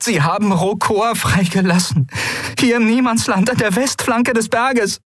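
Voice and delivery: high, rushed, pleading warning; desperate urgent plea. Acted. Lien begging her captors to stop the monster, desperately urgent. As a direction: beg them to act before time runs out.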